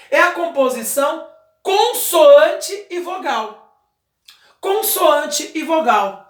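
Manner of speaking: slow, careful explanation with "consoante" stressed; slow teaching delivery. Shown as a demonstration. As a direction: lay out "consoante e vogal" word by word. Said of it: "teacher explaining syllable types".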